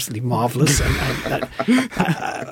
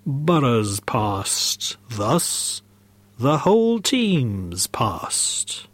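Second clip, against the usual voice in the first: very slow, stretched, breaking into whisper; ghostly voice; much slower than usual. Acted. Ghost explains to the team whose past, slow haunting voice. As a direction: intone it as a spirit, dragging each word. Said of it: "said a firm voice".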